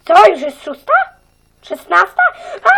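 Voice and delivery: loud, startled cry of alarm; a teacher realising she's late. Acted. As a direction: jolt up in sudden surprise.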